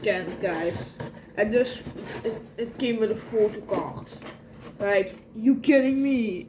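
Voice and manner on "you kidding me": normal talking voice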